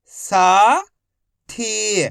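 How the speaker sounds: each syllable slowly held, first one rising. Shown as a demonstration. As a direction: break the word into long, separate syllables. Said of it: "एक अक्षर का उच्चारण करके".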